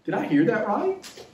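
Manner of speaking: can't believe what he just heard; stunned disbelief. Retold or imitated. Disciple to himself, hearing Jesus, in disbelief. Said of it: "the people probably thought".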